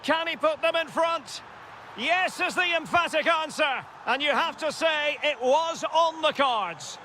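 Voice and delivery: high, rising commentary as the goal goes in; excited goal call. Acted. Commentator calling the winning goal, high and thrilled. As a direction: build the question, then answer it with triumph.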